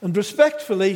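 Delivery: usual speaking voice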